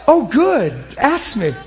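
high, bright eager reply; mock eager. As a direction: play it overeager and teasing. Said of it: "And I'm like".

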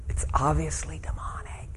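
hushed unvoiced aside; whisper. Real, not acted. Pastor whispering to congregation about the possessed girl. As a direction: lean in and breathe it out.

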